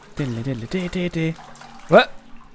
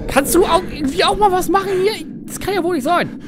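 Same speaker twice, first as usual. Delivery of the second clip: pained yell; 9 semitones higher than usual. Real, not acted. Gamer hit, yelling at his idle teammate in pain. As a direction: yelp in pain, then whine at your teammate.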